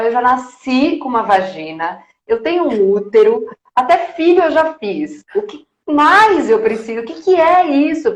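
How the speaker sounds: retold irritated outburst, not shouted; retold indignation. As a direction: climb in pitch with each point, fed up. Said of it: "mas eu ficava tão nervosa".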